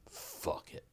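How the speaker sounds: near-silent whispered surrender; resigned giving up. Retold or imitated. Driver who can't hold it any longer, resigned whisper.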